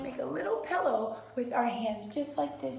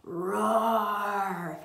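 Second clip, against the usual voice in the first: bellowing roar; 6 dB louder than usual. A narrator's reading. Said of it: "Lila bellows a".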